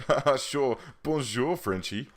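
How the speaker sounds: high, loud teasing with put-on laughter; hammed-up ribbing, laughing before and after; mock teasing. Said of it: "people just tease him and say that he's French".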